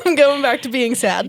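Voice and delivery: laughing through a mock-sulky line; a sulky turtle quote cracked up by giggles; laughing while voicing the gloomy turtle. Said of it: "then she's like".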